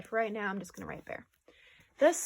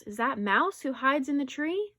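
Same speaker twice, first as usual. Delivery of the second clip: raised, louder calling question; 5 semitones higher, 6 dB louder than usual. A narrator's reading. Bear calling up to a hidden friend, raised voice. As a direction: call up into the tree. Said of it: "Bear calls".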